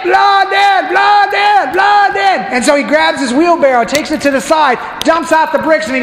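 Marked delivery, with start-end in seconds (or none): 0.0-2.4 cheering crowd. Retold or imitated. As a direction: roar it like a thrilled crowd. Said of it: "And they're all going".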